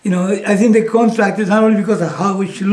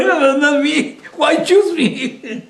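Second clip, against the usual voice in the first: high, laughing imitation of a reluctant pupil; mock protest; 8 semitones higher, much slower than usual. Child picked to read aloud, mock protest. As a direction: protest higher, dragging out the end with a laugh.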